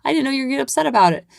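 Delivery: a hammed-up pushover parent; mock caving in. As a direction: pretend to cave to the kid, rushed and high. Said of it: "I can't give in and be like".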